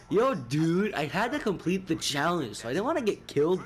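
light laid-back surfer dude; a hint of California surfer. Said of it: "He sounds, sounds like a surfer".

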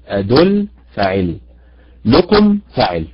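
أدل فاعل، لكم فاعل, stress on أدل and again on لكم; stress on first syllable. Shown as a demonstration. Teacher shows her class the stress on أدل and لكم. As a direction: tap out أدل then لكم, each stressed. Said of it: "ودليل أن الخطأ دائمًا يحدث".

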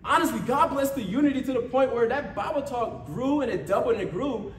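ordinary talk, nothing marked

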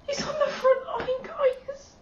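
high, tearful crying cry; near tears. Real, not acted. Fan telling viewers the wolf is on the front line, crying. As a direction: let the tears break through. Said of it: "I'm a mess".

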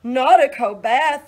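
loud, high moan of protest; moaning cow. Acted. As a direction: whine like a cow dreading cold water.

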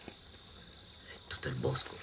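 slow whispered suspense; hushed whisper. A narrator's reading. Narrator whispering just before the gunshot. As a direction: breathe it out before the shot.

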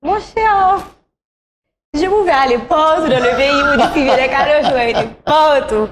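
high, animated outburst; big laughing exclamation; emphatic exclamation. Real, not acted.

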